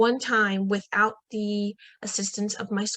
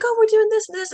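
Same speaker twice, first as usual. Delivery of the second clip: breezy cousin; 12 semitones higher, 6 dB louder, faster than usual. Retold or imitated. Cousin voiced casually.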